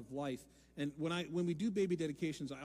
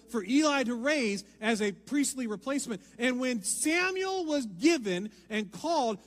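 louder, higher preaching build; 7 semitones higher, 9 dB louder than usual. Acted. Preacher telling Samuel's story with rising force. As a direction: lift it up and drive it to the crowd.

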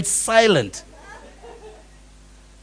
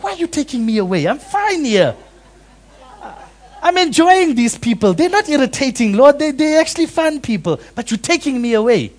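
mock whiny protest; 7 semitones higher than usual.

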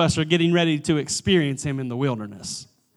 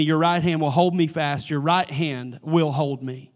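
soft scripture reading; 3 semitones lower, 3 dB quieter than usual. Real, not acted. Scripture read softly.

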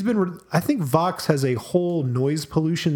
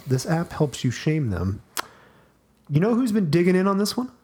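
ordinary delivery

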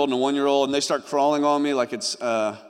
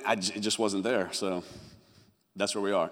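mock deadpan ending; 7 semitones lower, 9 dB quieter than usual. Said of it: "No, I'm just messing".

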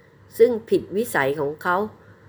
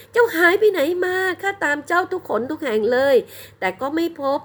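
anguished bride; 12 semitones higher than usual. A narrator's reading. Bride pleading with him.

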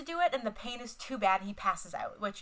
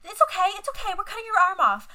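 breezy, high, loud imitation of a calm nurse; imitating a too-casual reassurance; mock casual; 10 semitones higher, 8 dB louder than usual. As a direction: toss it off like it's nothing. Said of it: "So fucking casual".

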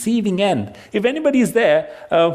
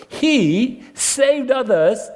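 higher, slow mock of the crucified; mocking declamation; 6 semitones higher, much slower than usual. A narrator's reading. Priests jeer among themselves at Jesus, mocking. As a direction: draw out the jeer in a raised pitch. Reading aloud the mockers at the cross.

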